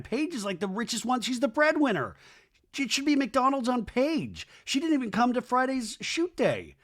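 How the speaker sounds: high-pitched, worked-up protest; mock indignation. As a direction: argue it way up high, hammed-up outrage. Ranting at who should pay.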